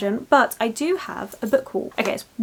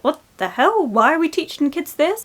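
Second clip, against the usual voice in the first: outraged disbelief; 6 semitones higher than usual. Retold or imitated. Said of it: "And Vera's like".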